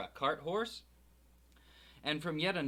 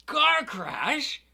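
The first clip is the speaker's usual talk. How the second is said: high loud roar; 11 semitones higher, 12 dB louder than usual. A narrator's reading. Hagrid roars in outrage at the Dursleys. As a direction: bellow the words back. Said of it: "roared Hagrid".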